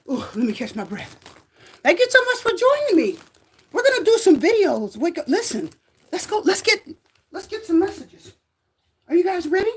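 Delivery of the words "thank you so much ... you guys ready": higher, bright presenter greeting to viewers; excited livestream host welcoming viewers; upbeat host intro. Acted. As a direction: pump up the live audience like a show host.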